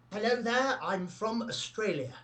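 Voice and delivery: high, tentative first go at an Aussie accent; attempted Aussie accent. Shown as a demonstration. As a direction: badly fake an Australian accent, pitched high on "Hello there". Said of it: "The Aussie accent sound like this".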